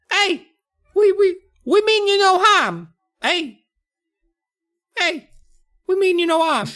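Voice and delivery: very high little gnome voice; tiny gnome insisting he means no harm; squeaky gnome pleading. Acted. Chip reassuring the frightened kids, very high voice.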